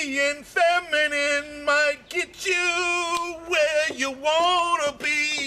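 lightly voiced high, sweet advice; high-pitched advice. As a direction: lift the pitch and drawl it out. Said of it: "my vocal cords go up, like, several octaves".

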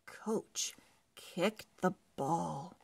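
ordinary delivery

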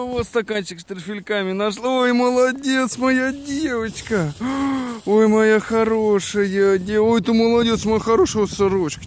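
high, excited praise for a dog; talking to a dog. Real, not acted. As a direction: gush over the dog with delighted gasps. Praising dog that found treats.

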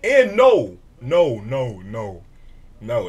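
loud repeated no; loud denial. Real, not acted. Reactor rejects Shaq's claim, loud flat denial. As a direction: shut the claim down hard.

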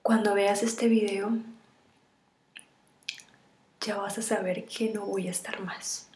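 tearful, half-whispered farewell; tragic heroine sorrow. Acted. Farewell video to lover.

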